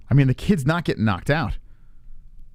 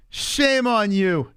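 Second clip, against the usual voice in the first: slow, high drawn-out scolding; slow sarcastic shame on you; mock scolding; 6 semitones higher, much slower than usual. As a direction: stretch every word sarcastically.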